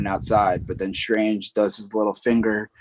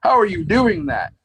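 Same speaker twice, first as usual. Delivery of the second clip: much higher, louder disbelief; baffled and impressed, pitched up; amazed question; 10 semitones higher, 5 dB louder than usual. Retold or imitated. Strange amazed that Peter's body still dodges, higher and louder. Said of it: "He goes".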